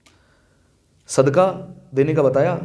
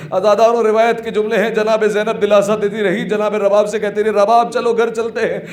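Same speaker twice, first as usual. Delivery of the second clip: raised, higher-pitched lament narration; mourning sermon; 7 semitones higher, 4 dB louder than usual. Acted. Preacher to mourners about Zainab consoling Rabab, plaintive lament. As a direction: carry the mourners along in a plaintive high voice.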